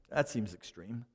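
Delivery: usual speaking voice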